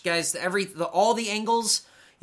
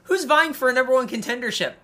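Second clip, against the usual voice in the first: incredulous listing; 6 semitones higher, 6 dB louder, slower than usual. Real, not acted. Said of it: "mocking roster list".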